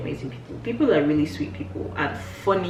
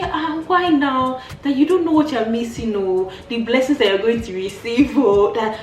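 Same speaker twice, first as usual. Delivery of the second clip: cajoling; 5 semitones higher, 4 dB louder than usual. Retold or imitated. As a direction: sweet-talk him into it, hammed up. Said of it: "Look at me cajoling".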